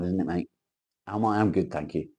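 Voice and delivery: ordinary talk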